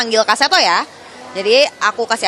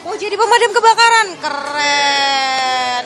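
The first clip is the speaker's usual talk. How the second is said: excited cheering; 3 semitones higher, slower than usual. Real, not acted. Reporter to a child who named a dream job, excited cheering.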